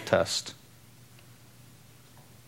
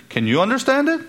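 challenging question; 14 semitones higher, 4 dB louder, faster than usual. Acted. Challenging the congregation.

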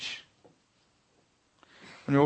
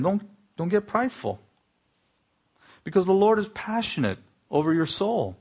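urgent warning; 8 semitones higher than usual. Retold or imitated. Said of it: "And he warned them".

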